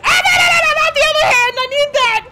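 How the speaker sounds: high, loud laughing yell of panic; panicked shout. Real, not acted. Gamer pleading as boss targets other hand, panicked shout. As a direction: beg loudly through a laugh.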